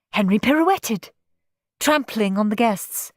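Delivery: plain reading voice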